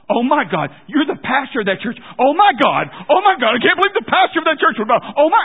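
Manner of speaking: high, shouted astonishment, escalating; shocked outburst. Acted. Passenger realizing his driver is the pastor, shouting in shock. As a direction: freak out loudly, voice shooting way up.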